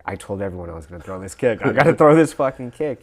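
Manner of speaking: self-talk rising to a loud shout; pumped self-talk. Retold or imitated. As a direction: psych yourself up into a loud burst. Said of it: "I remember being like".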